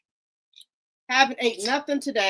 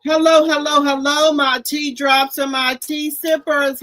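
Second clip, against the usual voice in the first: booming hellos to open the stream; loud opening greeting; 2 semitones higher, 8 dB louder than usual. Real, not acted. Host opening her live to viewers, loud welcome. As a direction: greet the whole crowd loudly.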